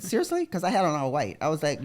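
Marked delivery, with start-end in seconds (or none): 0.0-0.5 high, incredulous squeak; retold disbelief. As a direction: act shocked at the absurd question. Retold disbelief while bleeding.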